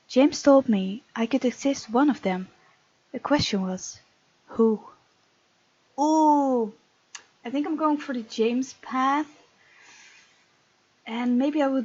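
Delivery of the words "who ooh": ordinary talk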